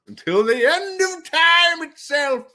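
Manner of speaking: high, slow, hammed-up declaration ending in a loud whoop. To chat about the endless joke, high hammed-up proclamation then a whoop. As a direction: build up then cheer Woo.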